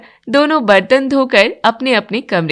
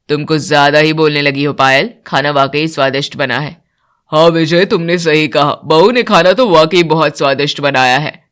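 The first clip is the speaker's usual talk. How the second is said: much lower, steady male voice; older man; 7 semitones lower than usual. Acted. Father and son praising the bride's cooking, much lower voice. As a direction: speak as the elder men of the house. Men of house praise food.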